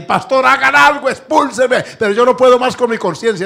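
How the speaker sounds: anguished shout of confession; crying out on her knees. Retold or imitated. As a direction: beg the pastor through tears. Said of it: "se soltó de rodillas a llorar".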